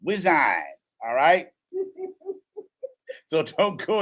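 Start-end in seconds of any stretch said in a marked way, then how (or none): 1.7-2.3 high laugh. Real, not acted. Laugh at whiskey joke.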